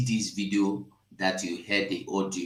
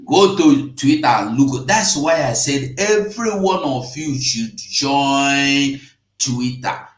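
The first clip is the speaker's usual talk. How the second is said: 1 urging shout; 4 semitones higher, 8 dB louder than usual. Real, not acted. Urging viewers to join Twitter.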